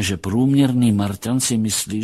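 usual speaking voice

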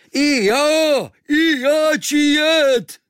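high, loud, slow cry; eager shout. Acted. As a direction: shout to be taken along. Kvíkvék eagerly joins in.

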